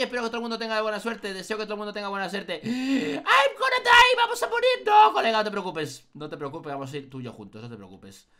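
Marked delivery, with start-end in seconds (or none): 2.6-4.7 high, slow panicked wail; panicked cry. Real, not acted. Panic entering maze. 4.8-8.3 reassuring. Real, not acted. Reassuring friend after panic.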